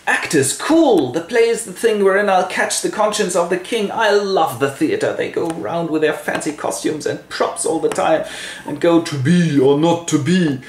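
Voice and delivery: high-pitched theater-lover's excitement; enthusiastic Hamlet. Acted. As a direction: gush about the stage, voice lifted. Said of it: "And Hamlet is enthusiastic".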